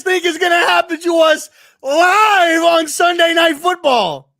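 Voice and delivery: loud and high, laughing through real frustration; exasperated fan yelling about another prime time game; angry laughing shout. Real, not acted. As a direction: shout it out half laughing, half furious. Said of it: "I'm trying to laugh because I'm pissed".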